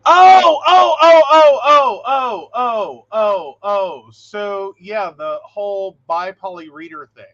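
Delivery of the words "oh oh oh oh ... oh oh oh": string of ohs, loud and high, falling away; loud repeated oh. Real, not acted. Remembering the shared forum post, loud exclamation. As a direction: shout of recognition cascading down in steps.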